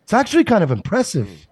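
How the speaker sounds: earnest Boston citizen; concerned Mark Wahlberg. Acted. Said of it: "impression of a concerned citizen Mark Wahlberg".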